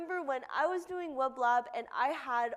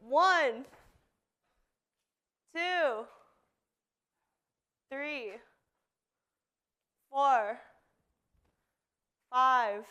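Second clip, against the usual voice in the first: counting workout reps; 2 semitones higher, 6 dB louder than usual. Real, not acted. Counts push-ups aloud.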